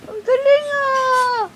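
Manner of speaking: loud, high happy cheer; hammed-up joy. Acting out joy for the child. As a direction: beam and cheer.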